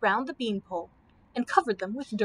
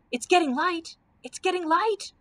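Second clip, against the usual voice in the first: high, excited cry, moderately louder; excited scream; 7 semitones higher, 4 dB louder than usual. A narrator's reading. Dorothy spotting daylight from the tube, screaming excitedly. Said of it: "screamed Dorothy in her excitement".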